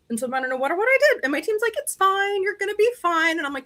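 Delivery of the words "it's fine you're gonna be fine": high-pitched mock reassurance; breezy reassurance. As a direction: brush off the worry in a high voice. Voicing her team's breezy reassurance.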